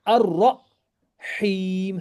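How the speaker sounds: Ar-Rahim slowly, ra and ha kept apart; separate ha. Shown as a demonstration. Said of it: "Ha is separate, ra is separate".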